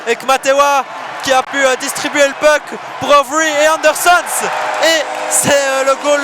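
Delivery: loud, high excited call; goal call. Real, not acted. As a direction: build to the goal with energy. Empty net goal call.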